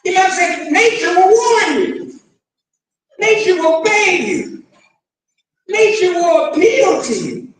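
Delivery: loud, high, driving sermon voice; fiery preaching. Acted. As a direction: preach it hard to the crowd. Preaching at full force.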